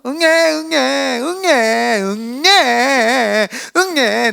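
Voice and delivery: drawn-out mock wailing baby; fussy baby cry. Preacher acting out a baby fussing over a wet diaper, crying. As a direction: whine "응애" over and over like a wet, fretting infant. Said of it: "젖으면 보채입니다".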